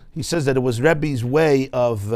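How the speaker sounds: normal talking voice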